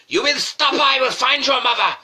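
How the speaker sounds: shouting teacher in a bad Japanese accent; mock Japanese-accented teacher yelling at a pupil; terrible Japanese accent. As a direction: bellow high and loud with a hammed-up Japanese accent. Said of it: "the teacher turned round to him like".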